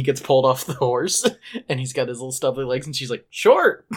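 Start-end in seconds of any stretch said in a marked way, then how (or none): 3.3-3.8 high, loud exclamation; shocked. Retold or imitated. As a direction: blurt it out in shock. Fiona reacts to short legs.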